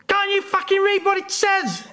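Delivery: high mock bellow right in the face; hammed-up furious bouncer yell. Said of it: "he bellowed into my face".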